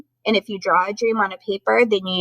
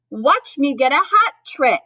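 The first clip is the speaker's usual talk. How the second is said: Santa calling out, slow and higher; big, raised, boastful call; storybook shout; 6 semitones higher, 5 dB louder than usual. A narrator's reading. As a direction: holler it to the reindeer, drawn out. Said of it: "He shouted to Belle and Beau".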